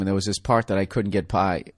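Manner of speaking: normal talking voice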